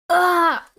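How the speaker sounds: loud, high cry; acted scream. Student shot on going outside, loud scream. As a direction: let out a sudden high scream. Character cries out when shot.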